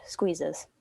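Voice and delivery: ordinary delivery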